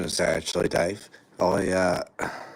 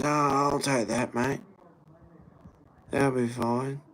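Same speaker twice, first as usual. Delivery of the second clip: higher, slow eager junkie voice; lightly played junkie happily taking the card; mock junkie taking card; 5 semitones higher, slower than usual. Junkie happy to take the card, higher slow mock voice. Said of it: "And he goes".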